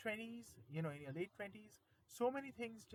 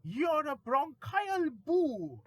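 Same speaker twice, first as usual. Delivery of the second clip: dramatic poem recitation; 4 semitones higher, 5 dB louder than usual. Acted. Careful cold sufferer scolds a careless one, mock-indignant recitation. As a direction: start measured, grow steadily more accusatory and emphatic.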